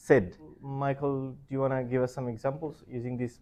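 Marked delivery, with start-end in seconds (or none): 0.1-0.3 "said" as if spelt S E D; short e in "said", rhyming with "bed"; correct pronunciation. Shown as a demonstration. Teacher confirming it for learners, short-vowel pronunciation. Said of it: "It's like S E D".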